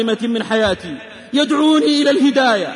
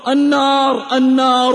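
raised, drawn-out cry; mild narrated scream; 4 dB louder, slower than usual. A narrator's reading. Survivor recalling his burning friend, mild retold scream. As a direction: call out as the burning man, held short of screaming. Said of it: "يصرخ".

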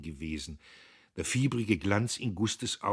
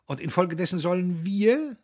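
laughing aloud; 12 semitones higher, 7 dB louder than usual. A narrator's reading. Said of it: "Und laut lachend".

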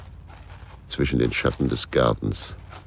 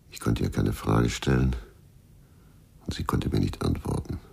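ordinary delivery

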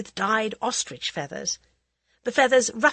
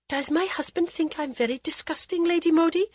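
fearful; 10 semitones higher than usual. Acted. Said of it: "unable to keep the fear from her voice".

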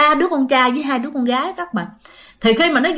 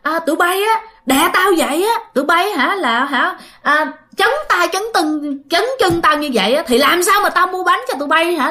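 higher-pitched acted scolding; retold scolding; 6 semitones higher than usual. Woman cursing ghost children pinning her down, retold scolding. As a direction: tell them off in a raised pitch, as a retold quote. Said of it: "chị mới chửi".

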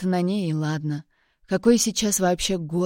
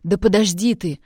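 louder, forceful shout to stop her; raised voice; 2 semitones higher, 6 dB louder than usual. Acted. He raises his voice so she stops fighting, loud shout. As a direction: cut through her yelling with a sharp raised voice. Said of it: "повысил я голос".

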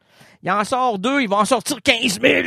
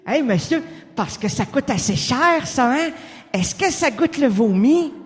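lightly voiced ghostly philosopher; Blaise Pascal voice; 5 semitones higher than usual. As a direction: play the dazed Pascal. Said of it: "la voix de Blaise Pascal".

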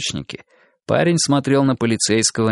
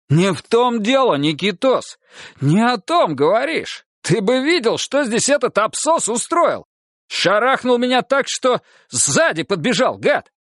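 high furious ranting; angry ranting; 12 semitones higher than usual. Acted. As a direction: rant in outrage. Sergeant ranting angrily.

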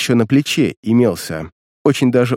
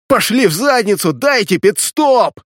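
desperate shout; 8 semitones higher, 4 dB louder than usual. Acted. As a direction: yell it at the camera. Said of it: "и закричал в неживой стеклянный глазок".

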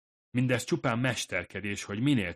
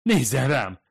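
"Nézzen rám!" moaned, loud and high, begging; moaning plea; 5 semitones higher, 9 dB louder than usual. Acted. Fumiko begs the ghost to look at her, moaning plea. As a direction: plead instead of commanding. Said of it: "nyögdécselte".

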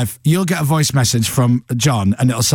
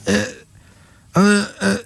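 mumbling [name]; 7 semitones higher than usual. Retold or imitated. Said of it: "And he'd go".

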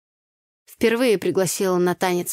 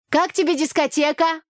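raised higher, calling into his ear; shouting over music; 9 semitones higher than usual. A narrator's reading. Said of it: "прокричала я парню в ухо".

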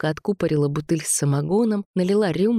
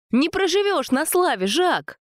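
high, indignant cry; indignant retort; 12 semitones higher than usual. A narrator's reading. Sasha argues with Jacques over the find, high indignant retort. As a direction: throw it back at him, pitched right up. Sasha shouting back in quarrel.

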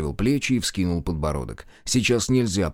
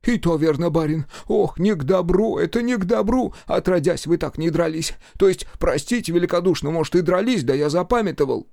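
put-on fretting old servant; 9 semitones higher than usual. A narrator's reading. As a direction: fuss and fret over the master.

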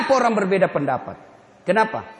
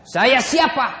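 louder, suspicious demand; gruff challenge; 2 semitones higher, 7 dB louder, slower than usual. Acted. The sick man demands who is at his door, gruff. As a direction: bark it through the door.

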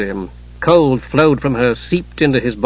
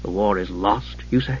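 low, soft, doubtful question; doubtful, troubled; 5 semitones lower, 10 dB quieter than usual. A narrator's reading. Knight questioning his mother's news, quietly troubled. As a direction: drop low and quiet, unsure. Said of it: "Steele was doubtful, troubled".

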